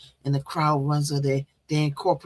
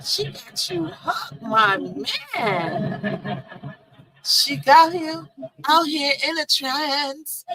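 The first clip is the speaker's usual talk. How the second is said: laughing tease; 9 semitones higher, much slower than usual. Real, not acted. Laughing while teasing Tiger.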